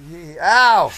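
sudden loud ow; pained yelp. Real, not acted. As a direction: yelp from a knee in the back. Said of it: "She just kneed me".

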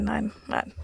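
whispered no, trailing off. Real, not acted. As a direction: drop to a whisper. Denies playing at the lake.

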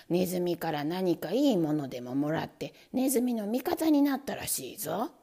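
low, loud suspicious grumble; angrier man. Acted. Man suspecting the trap, low and angry. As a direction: growl low that the trap is in league. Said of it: "ますます怒って言いました".

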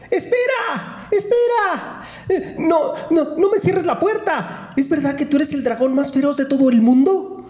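very high, eager little princess. Acted. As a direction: squeal up high like a small girl begging. Storyteller voices princess Elizabeth.

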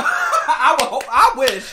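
laughing. Real, not acted. Laughing at the mix-up.

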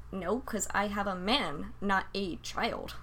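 slow, flat put-down; deadpan reply. Retold or imitated. Answering coworkers, slow deadpan retort. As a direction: drag it out dryly. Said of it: "and I'm like".

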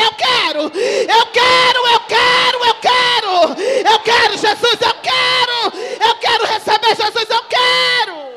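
loud, high, drawn-out begging; pleading cry. Retold or imitated. Someone weeping in church begs for Jesus, loud high pleading. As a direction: shout it like someone desperate for Jesus. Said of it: "alguém chorando, dizendo".

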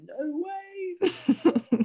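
high squeaky no way; hammed-up high-pitched refusal; mock disbelief. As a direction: squeal it in fake horror. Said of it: "I'm like".

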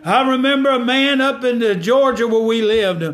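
climbing gospel preacher cadence; preaching at full force. Acted. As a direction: lift the congregation up.

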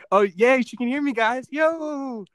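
high, excited outburst; real excitement. Real, not acted. Streamer learns she can hear me, delighted high cheer. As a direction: cheer it loud and high to the guys.

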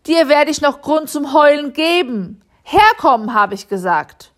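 mother scolds her son Hannes on the stairs, loud and furious; angry yelling. A narrator's reading. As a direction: yell at the boy who tore his Sunday trousers. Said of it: "schrie Frau Müller".

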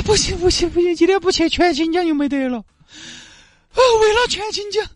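high, straining woman urging herself on; high determined woman's voice; self pep talk. Acted. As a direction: coach yourself forward through dizziness. Li cheering herself on.

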